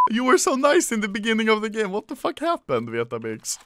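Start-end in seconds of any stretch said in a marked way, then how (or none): none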